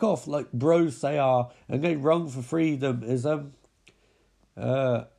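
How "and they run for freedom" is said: ordinary talk, nothing marked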